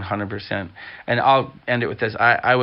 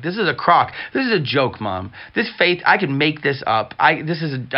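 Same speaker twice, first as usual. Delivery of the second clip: a retold outburst at mom, slightly raised; angry rant; 4 semitones higher than usual. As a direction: unload on her, mildly heated. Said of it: "I unloaded on her".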